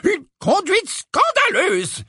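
slow, high, spluttering outrage; Professor Tufty. A narrator's reading. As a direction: splutter in scandal, climbing in pitch. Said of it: "s'écria le professeur Tufty".

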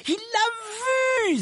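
very high, stretched-out cry; thrilled high-pitched exclamation; exclaiming. A narrator's reading. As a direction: draw it out in high excitement. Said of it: "s'exclama Harry".

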